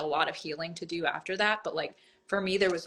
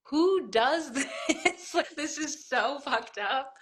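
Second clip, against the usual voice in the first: higher, slow, laughing through the words; laughing disbelief; 8 semitones higher, 3 dB louder, much slower than usual. Real, not acted. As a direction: laugh in incredulous disgust.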